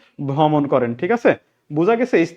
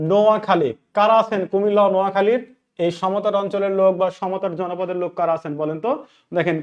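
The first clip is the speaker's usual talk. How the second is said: ordinary talk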